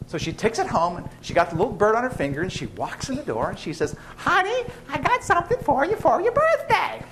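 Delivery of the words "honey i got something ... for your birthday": much higher, sing-song wife voice; sweet wife calling out. Acted. Wife announcing the birthday present to her husband, much higher sing-song voice. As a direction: coo it like a proud wife with a gift. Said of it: "she says it just like that".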